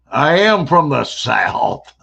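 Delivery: loud, slow, hammed-up Southern drawl; Southern accent. Actor's mock reply to the crew, broad Southern accent. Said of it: "we always thought you were from the South".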